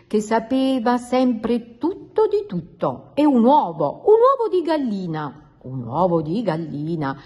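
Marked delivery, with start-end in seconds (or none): 3.1-5.5 confident, higher-pitched correction; rising to a high, emphatic verdict; know-all announcement. A narrator's reading. Know-all frog corrects her friend. 5.6-7.2 lower, doubtful repeat; baffled low-pitched questioning; puzzled echo. A narrator's reading. Puzzled frog echoes the unknown word.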